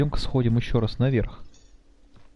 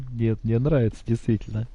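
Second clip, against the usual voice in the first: ordinary talk, nothing marked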